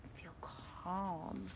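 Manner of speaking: ordinary delivery, nothing marked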